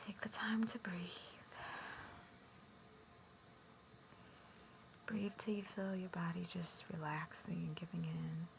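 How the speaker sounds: soft, hushed meditation voice; guided meditation. Acted. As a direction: ease them into relaxing with breathy near-whispers and long pauses. Begins leading guided meditation.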